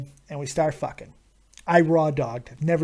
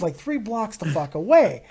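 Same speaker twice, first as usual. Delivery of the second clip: normal talking voice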